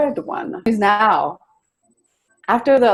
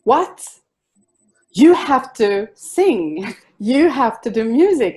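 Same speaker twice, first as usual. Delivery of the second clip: mock shout, higher and drawn out; retold shout; 5 semitones higher, 4 dB louder, slower than usual. Friend urging her to start singing, retold shout. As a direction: exclaim it like a mild, imitated shout. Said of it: "And she just shouts".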